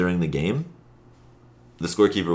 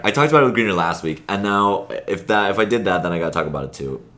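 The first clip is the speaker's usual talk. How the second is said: usual speaking voice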